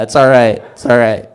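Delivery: usual speaking voice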